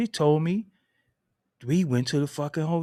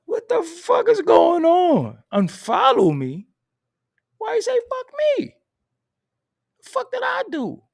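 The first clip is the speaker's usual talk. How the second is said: much higher and louder, slowed disbelief; squealed outrage; 13 semitones higher, 7 dB louder than usual. Real, not acted. Told to unfollow him, high shrieking disbelief. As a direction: shriek it like you can't believe it.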